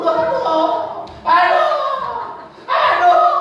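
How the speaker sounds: rising mock wails of complaint; mock whining. Mocking complainer's groans. (mengaduh pura-pura, makin tinggi)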